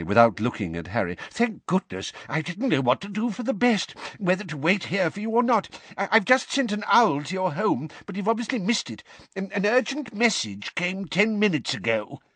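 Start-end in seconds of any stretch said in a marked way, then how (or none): 1.3-12.2 put-on high, fretful old clerk voice; a flustered timid old wizard babbling fast; anxious old wizard. A narrator's reading. Perkins pours out his worry to Arthur in a high fret.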